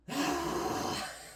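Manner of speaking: big, voiceless exhale; frustrated huff of breath; exasperated sigh. Real, not acted. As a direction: let out a heavy sigh. Frustrated with the crooked hairdo.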